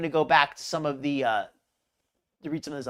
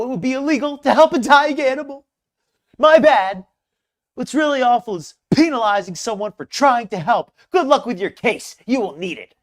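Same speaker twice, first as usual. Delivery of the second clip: louder, higher mock outrage; dramatic reading; 4 semitones higher, 5 dB louder than usual. Shown as a demonstration. Vlogger performing Foodie's angry reply, very dramatic. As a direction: ham up the indignant post line by line. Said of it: "That's my very dramatic, very, very dramatic reading".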